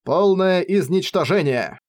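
usual speaking voice

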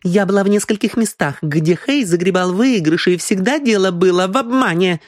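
high, insistent woman's voice; accusing woman. Acted. Аврора testifies that Хэй always cheated, insistent. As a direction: testify against him in a high voice.

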